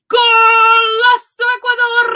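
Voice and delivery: high, loud, drawn-out goal call with trilled R; excited soccer announcer. Acted. Announcers at a soccer game hail a goal, excited shout with long rolled R. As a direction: belt it out slowly like a soccer commentator. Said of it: "the announcers for a soccer game, they may say".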